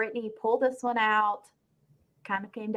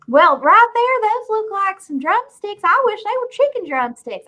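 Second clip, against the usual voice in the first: put-on Jasmine's Southern accent; 12 semitones higher, 7 dB louder than usual. Host mocks a houseguest's accent to her co-hosts in a hammed-up, very high Southern voice. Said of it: "how terrible her accent got".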